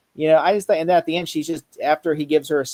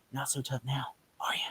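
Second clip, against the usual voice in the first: soft gloating whisper, much quieter; menacing whisper; 3 semitones lower, 13 dB quieter than usual. Retold or imitated. Fan retelling Doom taunting the Surfer, mock villain whisper. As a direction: breathe the taunt like a villain leaning in.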